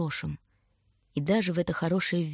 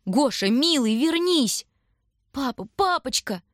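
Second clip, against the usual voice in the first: high-pitched pleading calls; women's high farewell cries; crying out by name; 7 semitones higher, 3 dB louder, slower than usual. A narrator's reading. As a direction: call desperately after someone leaving. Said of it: "Женщины кричали, звали по именам".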